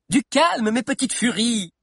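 high, playful call for calm; calming the crowd. A narrator's reading. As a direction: rein in an excited group. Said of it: "tempéra Sabi".

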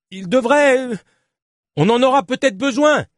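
high, hesitant shout. Acted. Journalist answering his angry companion in the storm, hesitant shout. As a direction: shout back, searching for words.

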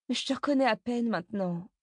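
high-pitched mother's lament; dismayed mother. A narrator's reading. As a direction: protest in a high, distressed mother's voice. Dismayed mother facing changed son. (mère désemparée, voix aiguë)

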